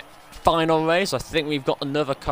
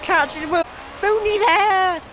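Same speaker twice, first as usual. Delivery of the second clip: excited; 5 dB louder than usual. Real, not acted. Chasing the cars ahead.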